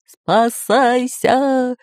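high, very drawn-out call; Бульбуль bellowing. A narrator's reading. Pirate warns the others, drawn-out bellow. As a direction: stretch it into a long warning cry. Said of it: "ревел пират Бульбуль".

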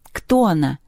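ordinary delivery

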